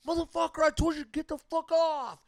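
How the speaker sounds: high, loud angry outburst; imitated anger. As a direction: play the fed-up bartender yelling. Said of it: "he was mad at the guy".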